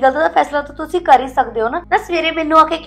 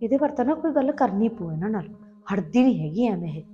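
grumbling to herself; 3 semitones lower, 7 dB quieter than usual. Acted. Wife grumbling to herself in kitchen.

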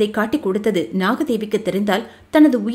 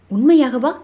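eager; 3 semitones higher, 3 dB louder than usual. A narrator's reading. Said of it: "கேள்வியில் ஆவல் இருந்தது".

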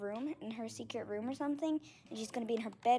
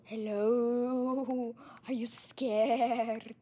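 loud, slow mock ghost; hammed-up ghost haunting; ghostly voice; 5 dB louder, slower than usual. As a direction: boom it out like a ghost. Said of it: "like, a ghostly voice".

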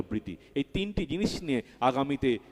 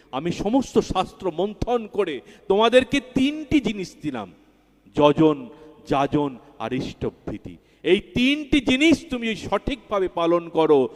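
raised, solemn voice quoting the master; voicing Thakur's words; 5 semitones higher, 5 dB louder than usual. Preacher quoting Thakur to the congregation, raised and solemn. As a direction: speak as the master giving his teaching. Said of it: "ঠাকুর বলছেন".

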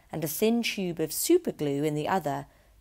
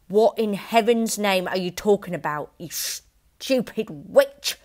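louder, slow furious shouting; angry shout; 4 semitones higher, 7 dB louder than usual. A narrator's reading. Husband shouting at his wife, furious. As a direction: snap at the stupid witch. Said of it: "Mister Wormwood shouted".

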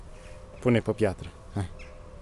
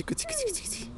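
much higher, soft sing-song cuți cuți; squeaky cat call; 9 dB quieter than usual. Real, not acted. Parent luring the cat Rolly with a squeaky high call. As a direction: pitch it way up to tempt the cat. Coaxing a frightened cat closer.

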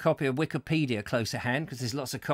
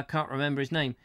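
normal talking voice

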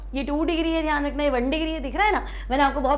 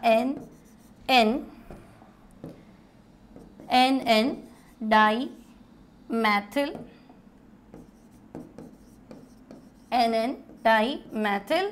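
syllables drawn out, lower, with long gaps; slow dictation; 3 semitones lower, much slower than usual. Real, not acted. Teacher writing out the name for students, slow dictation. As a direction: say it slowly as if writing along.

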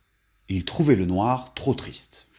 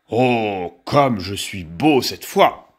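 slow, lower, self-admiring wolf; admiring exclamation; 4 semitones lower, 3 dB louder, much slower than usual. A narrator's reading. Wolf in peacock feathers to his mirror, slow admiring exclamation. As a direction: savour it slowly, like a vain wolf.

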